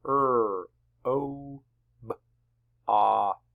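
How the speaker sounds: "Er, o, b, ot" as separate sounds: rr, oh, b, ah, t; sounded out. Shown as a demonstration. Teacher has class sound along, "Er, o, b, ot" segmented. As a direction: stretch "Er, o, b, ot" with a pause after each sound. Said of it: "Sound it with me".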